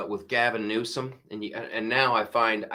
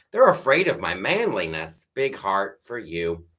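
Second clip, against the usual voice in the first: ordinary talk, nothing marked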